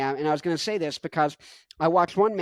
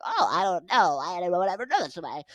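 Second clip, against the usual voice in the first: mocking whiny voice. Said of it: "The way Clownface over here is talking like".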